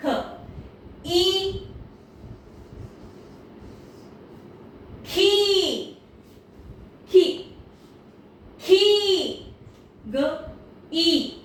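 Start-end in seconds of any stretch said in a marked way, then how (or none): 1.1-9.5 खी drawn out long and pitched high. Shown as a demonstration. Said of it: "आपने ऐसे बोलना है". (खी को लंबा और ऊंचा खींचकर बोलें)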